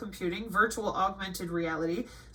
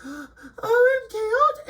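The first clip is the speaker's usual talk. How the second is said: a high hammed-up cry, much higher and louder; mock crying wail; 12 semitones higher, 7 dB louder, much slower than usual. Imitating traumatized viewers crying out at a scary video, mock wail. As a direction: wail it like a fake tragic victim. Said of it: "let people sit there and cry".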